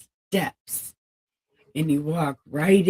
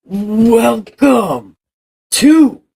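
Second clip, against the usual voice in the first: big loud rising welcome; booming welcome; 5 semitones higher, 13 dB louder, much slower than usual. Pretend. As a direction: call it out like a herald. Grand spooky welcome.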